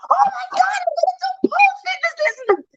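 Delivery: high squeal of joy, quick; thrilled high-pitched retold squeal; excited scream. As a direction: shriek it out with delight. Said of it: "mind you, I'm screaming, I'm yelling".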